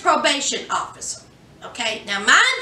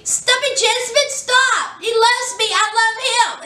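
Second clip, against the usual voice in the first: high, pleading mother begging her daughter to stop; a mother pleading her daughter to stop, voice pitched up; 7 semitones higher than usual. Retold or imitated.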